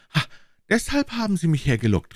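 high, breathless outburst; breathless cry. A narrator's reading. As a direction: gasp it out as the trap dawns on him. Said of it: "rief er atemlos".

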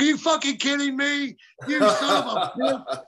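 high, laughing mock disbelief; laughing outrage. Mentor laughing in disbelief at the denial, high laughing outburst. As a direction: retell his shocked laugh-yell. Mentor shocked at not-father claim.

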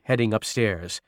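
ordinary delivery

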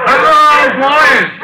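loud high call to the dogs below. Acted. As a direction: hail the hounds from the window. Calling out the window to hounds.

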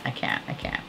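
breathless whisper, overwhelmed; hushed, overwhelmed whisper to herself; whispered I can't. Real, not acted. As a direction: whisper it as if losing it. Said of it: "calm down".

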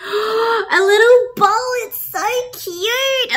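high, slow, squealing over something cute; gushing. Real, not acted. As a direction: coo over a tiny bowl. Delighted by the cat bowl.

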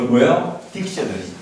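usual speaking voice

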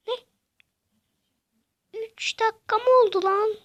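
loud, high disbelief; astonished gamer shout; shocked outburst. Real, not acted. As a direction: blurt it out stunned. Shocked at elapsed game time.

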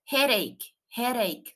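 "Headache" said as two clear syllables, HED-eik; pronunciation demo. Shown as a demonstration. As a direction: model "headache" carefully for learners, ending in a long "eik". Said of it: "Escuchemos la pronunciación".

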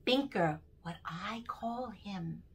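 lively, sing-song storytelling read; poem for children. Acted. Reading a Binker poem aloud to young children, sing-song. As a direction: bounce through the rhymes for little listeners.

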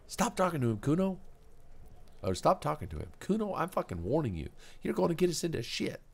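put-on high, sharp warning in a kid's voice; angry kid girl. Girl warning Kuno over the fence, high and sharp. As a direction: snap at him to shut up.

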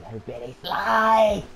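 high, loud, slow villain cry; hammed-up villain. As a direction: ham up the villain's outburst. Mock villain climax.

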